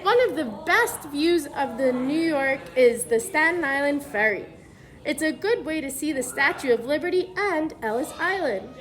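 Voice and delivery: put-on clear, even storybook read-aloud for kids; reading to children. Teacher reads the book to the class, storybook read-aloud voice.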